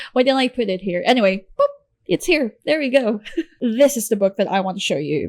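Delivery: usual speaking voice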